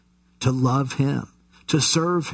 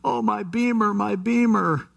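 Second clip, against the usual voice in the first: high, whining moan over his lost car; mock-wail for the Beamer, much higher; wailing injured yuppie; 8 semitones higher than usual. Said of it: "he was just going".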